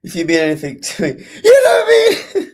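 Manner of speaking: laughing through a mock plea, pitch leaping on the second half. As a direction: imitate the desperate plea while cracking up, voice jumping way up. Mocking the clip's pleading line.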